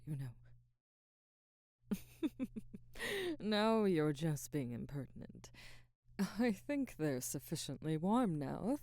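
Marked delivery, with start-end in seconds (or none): 1.9-3.3 high breathy laugh, caught off guard; amused little laugh. Real, not acted. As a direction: laugh lightly at the teasing. Laughs at being teased.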